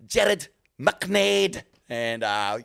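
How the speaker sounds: put-on slow, stretched Scottish accent on the Irish surfer names. Host mangling Irish surfers' names in a Scottish accent. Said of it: "I'm fucking saying Scottish".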